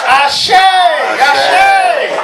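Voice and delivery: calling out Ashe. Real, not acted. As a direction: call Ashe out to the crowd. Call and response chant.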